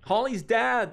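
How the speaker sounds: high, loud shout-out; excited streamer shout-out; calling out. Real, not acted. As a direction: call out the supporter's name, high and loud. Calls out thanks to a subscriber.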